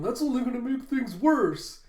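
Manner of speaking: high, loud mock panic; freaking out. Crew reacting to Luffy's plan, freaking out. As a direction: cry out in exaggerated alarm. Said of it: "the Straw Hats are all freaking out".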